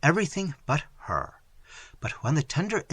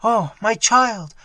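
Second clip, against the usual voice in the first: in a flood of tears; 8 semitones higher, 7 dB louder, slower than usual. Acted. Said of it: "in a flood of tears".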